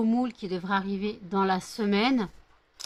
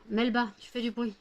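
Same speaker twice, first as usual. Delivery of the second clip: usual speaking voice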